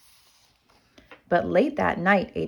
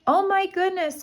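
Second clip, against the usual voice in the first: much higher alarmed cry; 12 semitones higher than usual. Acted. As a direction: panic as the floor shakes. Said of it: "cried the giantess".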